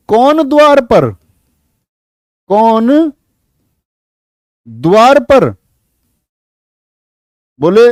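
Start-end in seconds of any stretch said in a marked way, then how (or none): 0.1-5.5 high, slow call through the door; Radha at the door. Acted. Radha asks who is at door.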